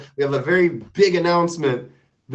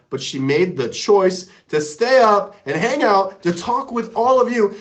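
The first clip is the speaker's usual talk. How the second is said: ordinary delivery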